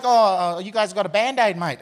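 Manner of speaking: light mock Aussie accent; casual Aussie mate. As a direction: toss it off like it's nothing, mate. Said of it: "she's like".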